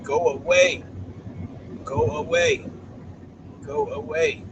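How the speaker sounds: mock dismissive dog voice; voicing the dog. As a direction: play the dog shooing the owner off. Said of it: "She's like".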